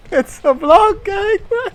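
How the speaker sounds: very high, loud shriek; shrieking laugh-shout. Real, not acted. Streamer shrieks the line again, very high and loud. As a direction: scream it in delighted disbelief.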